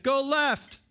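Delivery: high called-out direction from the tower; tower guide calling down. Retold or imitated. Tower lookout calling to someone lost in the maze, high call. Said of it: "someone up there could be like".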